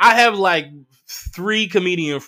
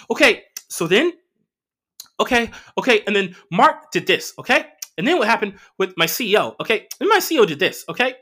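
higher, louder rush of okays and and-thens; mocking rambling girlfriend; 8 semitones higher, 4 dB louder than usual. Mocking his girlfriend's endless work story, higher and louder. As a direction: parody her breathless nonstop monologue.

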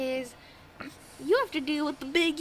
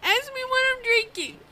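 playful insistence; 11 semitones higher, 5 dB louder than usual. Pretend. Insisting Bill ask her.

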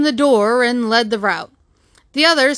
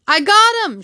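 high cry of capture; shouted; 9 semitones higher, 4 dB louder than usual. A narrator's reading. Policeman grappling his unseen enemy, high shout. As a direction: call it out high and urgent.

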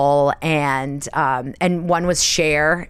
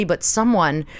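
usual speaking voice

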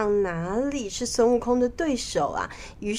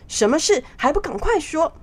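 raised, demanding royal voice; impatient king; 8 semitones higher, 4 dB louder than usual. Acted. King ordering his official to report right away, impatient. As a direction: demand an answer at once, pitched up.

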